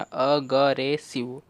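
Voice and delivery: "Aggressive" drawn out syllable by syllable; teacher modelling "aggressive" slowly; slow blended word. Shown as a demonstration. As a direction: stretch "aggressive" slowly for the class. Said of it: "देखिए क्या बना".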